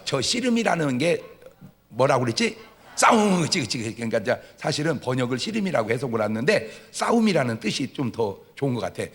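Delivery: normal talking voice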